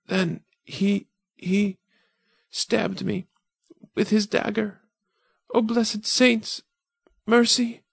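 put-on high girl's voice breaking off, then a slow cry for mercy; high dying girl's plea. Dying girl to the saints, high and pleading. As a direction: plead to the saints with the last breath.

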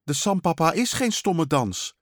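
put-on high, indignant child's retort; offended little brother protesting; calling out. A narrator's reading. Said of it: "riep Thijs".

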